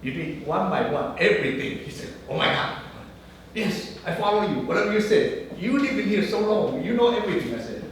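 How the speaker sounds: usual speaking voice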